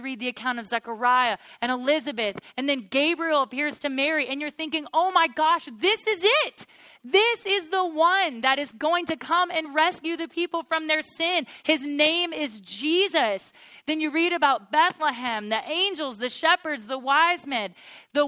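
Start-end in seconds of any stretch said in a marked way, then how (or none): 4.9-13.5 higher, louder gasp of wonder; mock first-time reader suddenly sees it; excited realization. First-time Bible reader realizing the Messiah has come, excited.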